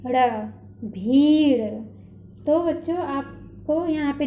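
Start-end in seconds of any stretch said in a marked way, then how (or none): 0.8-1.7 भीड़ with the ई held long and loud; drawn-out long ई. Shown as a demonstration. Teacher reads भीड़, drawing out its long ई. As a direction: stretch the ई in भीड़. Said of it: "खींच कर बोलना है".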